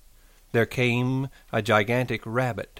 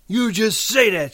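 high, loud retort; sharp scolding; 12 semitones higher, 5 dB louder than usual. A narrator's reading. Aunt doubting her nephew's promise, sharp high scolding. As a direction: cut him off sharply, pitched high. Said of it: "she said sharply".

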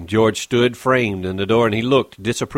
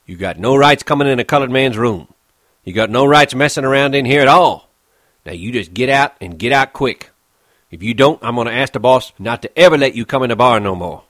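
louder, hard cold orders; cold anger; 3 semitones higher, 7 dB louder, faster than usual. Acted. Crooks orders Curly's wife out of his room, coldly angry. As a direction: throw her out with cold force. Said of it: "he said coldly".

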